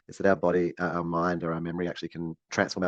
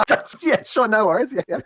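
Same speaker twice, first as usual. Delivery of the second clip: high squeaky laughing reply; 14 semitones higher, 8 dB louder than usual. Real, not acted.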